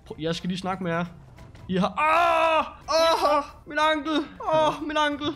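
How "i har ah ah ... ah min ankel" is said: high, loud cries of pain over the ankle; acted pain. Run over by a police car, screaming in pain. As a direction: yell like your ankle just got crushed.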